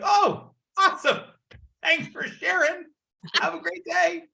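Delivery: high, singsong chirpy thanks; very high-pitched sunny dismissal; breezy cheerful brush-off. Acted. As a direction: brush them off with exaggerated cheer. Acts out cheerful brush-off of rejection.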